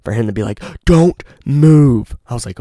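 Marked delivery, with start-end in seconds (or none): 0.8-2.2 loud, raised-pitch retold command; stern. Friend suddenly warning him to stay still, stern and loud. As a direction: imitate a sharp, stern order to freeze. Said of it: "never spoken to me like that".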